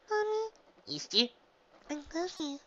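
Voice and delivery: put-on high small child's voice; sleepy little filly. A narrator's reading. Sleepy filly calling to her mother from bed, high voice.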